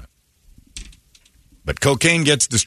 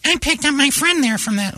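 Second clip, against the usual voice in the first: high falsetto mimicry of a woman; Megan. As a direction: pitch it way up, mock female voice.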